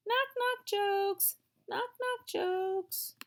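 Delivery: high, slow silly chant of the words; silly sing-song. Acted. Girl echoing the jokes to her unicorn, high and slow. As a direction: stretch it out like a giggly child.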